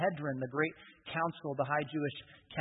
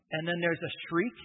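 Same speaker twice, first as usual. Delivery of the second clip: ordinary talk, nothing marked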